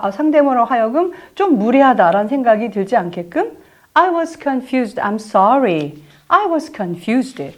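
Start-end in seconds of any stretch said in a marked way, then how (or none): 3.9-7.4 slow I was confused around I'm sorry; apologetic model sentence. Shown as a demonstration. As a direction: apologise gently and slowly. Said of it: "해볼까요?".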